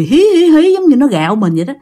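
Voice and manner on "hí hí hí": high-pitched mock giggle, "hee hee hee"; imitated ghost laugh. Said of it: "nó vừa ăn mà nó vừa cười".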